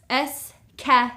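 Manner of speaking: escalier said slowly, "es-ca-li-er" split apart; escalier, spaced to count four syllables. Shown as a demonstration. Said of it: "three, four syllables".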